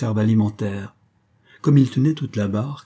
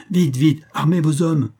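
higher, louder urgent order; urgent command; 5 semitones higher, 4 dB louder than usual. A narrator's reading. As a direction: urge the captain on in alarm. Hero urges captain to arm men. (ordre pressant et affolé)

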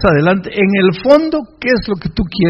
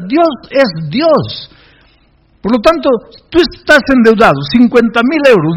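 building to high, loud proclamation; full-force preaching; 7 semitones higher, 6 dB louder than usual. Real, not acted. Pastor urging the indebted to trust God's power, rousing preaching. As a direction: drive each declaration home like a fired-up preacher.

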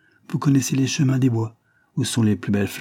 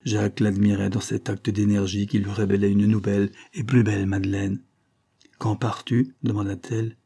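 ordinary delivery

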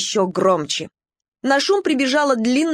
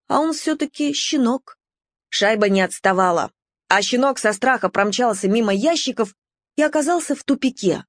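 ordinary delivery, nothing marked

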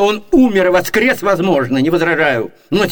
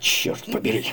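whispered curse, voiceless and much softer; cursing under breath; 12 semitones lower, 11 dB quieter than usual. Acted. Frustrated servant mutters a curse under his breath. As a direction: swear under your breath so nobody hears.

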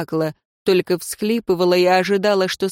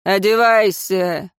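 barked order; 4 dB louder, slower than usual. A narrator's reading. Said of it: "и гаркнул".

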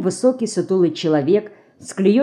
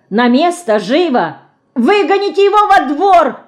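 high, loud panicked squeal; shrieking; 10 semitones higher, 6 dB louder than usual. A narrator's reading. Frightened detective begs to chase the dog outside, shrill squeal. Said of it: "завизжал сыщик".

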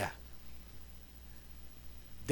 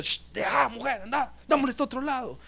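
higher, hushed shooing-away; 6 semitones higher, 7 dB quieter than usual. Acted. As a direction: shush her off to one side. Disciples shooing the woman away.

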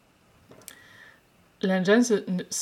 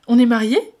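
much louder, high shocked question; 5 semitones higher, 14 dB louder than usual. Acted. Wei Ying stunned to learn they are married, loud and high. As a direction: gasp it in disbelief. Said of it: "en état de choc".

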